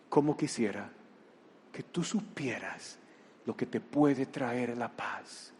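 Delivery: tender, soft plea. Acted. Jesús habla con ternura.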